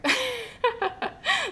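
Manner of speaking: high, light burst of laughter; sheepish high-pitched laugh; giggle. Real, not acted. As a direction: giggle at your own silly bit. Laughs after joke accent.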